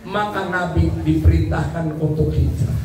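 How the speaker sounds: ordinary talk, nothing marked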